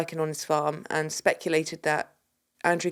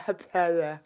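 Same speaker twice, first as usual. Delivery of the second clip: salivary retainer sound. Shown as a demonstration. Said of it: "sounding very salivary".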